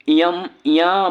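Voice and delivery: इयम, इया drawn out slowly for the nasal; slow nasal demo. Shown as a demonstration. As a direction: sound out इयम, इया one by one. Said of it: "अनुनासिक word". (धीमा अनुनासिक उच्चारण)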